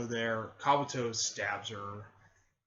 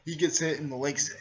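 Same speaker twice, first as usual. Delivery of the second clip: ordinary delivery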